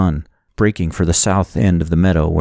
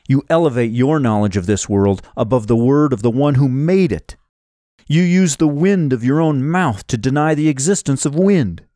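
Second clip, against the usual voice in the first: higher, declamatory rebuke; righteous hero; 5 semitones higher than usual. A narrator's reading. Hero with sword at villain's throat, righteously rebuking. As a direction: drive the argument home with raised pitch.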